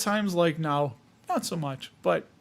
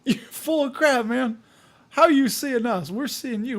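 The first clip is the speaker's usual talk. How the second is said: mock viewer heckle; 6 semitones higher than usual. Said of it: "the people are like".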